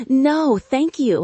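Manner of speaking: polite thanks while fighting laughter; holding back laughter. Acted. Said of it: "trying to keep it together".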